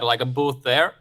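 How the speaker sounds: ordinary delivery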